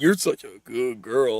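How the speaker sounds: put-on low, slow creepy old man. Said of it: "creepy old men be saying. They're like".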